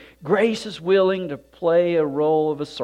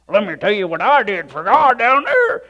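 louder, higher, puffed-up boast; mock bragging; 6 semitones higher, 7 dB louder than usual. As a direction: ham up a show-off in heaven.

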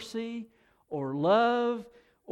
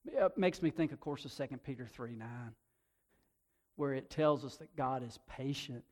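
usual speaking voice

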